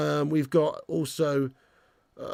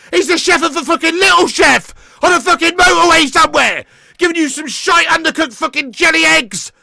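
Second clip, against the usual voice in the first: much higher and louder, shouting every line at full force; furious rant; 10 semitones higher, 12 dB louder than usual. Real, not acted. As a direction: rage at your own team's manager, yelling it out. Fan raging as Palace score chances.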